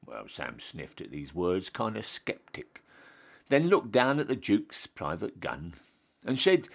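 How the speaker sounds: ordinary delivery, nothing marked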